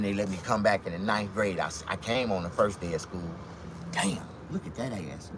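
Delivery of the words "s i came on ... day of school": ordinary talk, nothing marked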